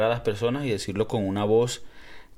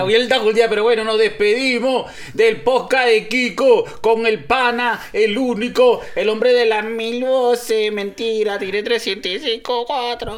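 much higher, louder announcer hype; hyped announcer; 11 semitones higher, 7 dB louder than usual. Acted. Host hyping the guest in a loud announcer voice. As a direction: shout the sign-off like a showman. Hosts hyped podcast farewell.

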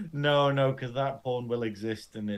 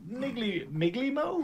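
unsure questioning; 9 semitones higher than usual. Real, not acted. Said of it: "unsure of the name".

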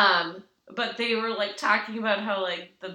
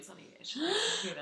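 soft breathy whisper; 12 semitones higher, 9 dB quieter, faster than usual. Real, not acted. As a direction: whisper it as a joke. Joking whisper.

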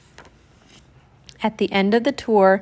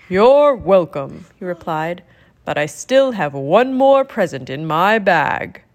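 put-on a warm, jolly old Santa, fuller in tone; 3 semitones lower, 4 dB louder than usual. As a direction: speak as a kindly Santa Claus.

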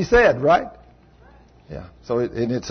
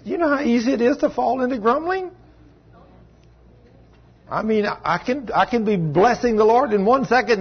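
animated; 6 semitones higher than usual. Real, not acted. Preacher worked up about grumbling.

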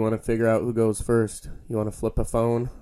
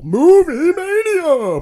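game show announcer; 11 dB louder, much slower than usual. Pretend.

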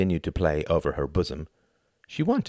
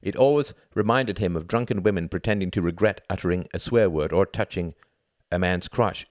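ordinary delivery, nothing marked

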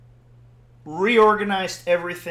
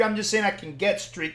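ordinary delivery

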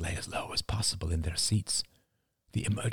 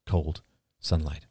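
ordinary delivery

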